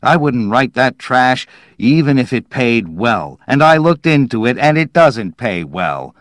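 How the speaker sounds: disgusted outburst. A narrator's reading. Dowland refusing to write science fiction, disgusted outburst. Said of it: "Disgustedly, he started toward the stairs".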